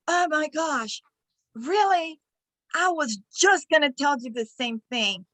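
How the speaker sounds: high-pitched feigned surprise; hammed-up "what a coincidence" retort; mock astonishment. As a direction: play at being amazed, as a comeback. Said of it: "a way to get back at people by telling them".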